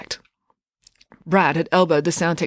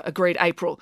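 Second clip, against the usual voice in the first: ordinary delivery, nothing marked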